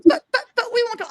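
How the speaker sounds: high, fast, giggly pleading; pleading steer voice. Retold or imitated. Steers begging her for food, high giggling plea. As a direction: blurt it out like a guilty kid hiding a laugh. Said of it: "And they're like".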